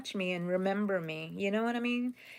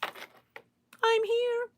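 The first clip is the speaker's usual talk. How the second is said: squeaky reply. Real, not acted. Answering a viewer's message.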